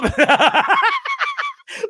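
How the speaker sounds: a very high burst of laughter; high squealing laugh. Real, not acted. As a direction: lose it at the video with a shrieking laugh. Cracking up at the video.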